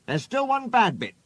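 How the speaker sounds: lightly anxious, pitched high; high-voiced mild worry. A narrator's reading. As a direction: fret a little in a high voice. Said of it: "said Rusty anxiously".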